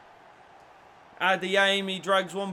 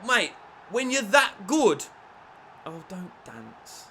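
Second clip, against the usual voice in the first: ordinary talk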